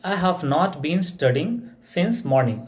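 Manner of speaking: very slow, each word laid out for students; slow model sentence. Shown as a demonstration. Teacher giving the English answer, spoken very slowly. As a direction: dictate it slowly so the class can follow. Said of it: "ठीक है, तो कैसे होगा?".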